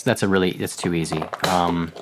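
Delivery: normal talking voice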